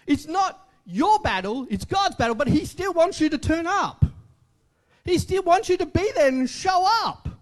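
ordinary talk, nothing marked

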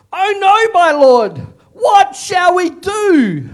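high, loud panicked cry; panicked servant. Acted. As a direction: cry out in alarm, drawn out.

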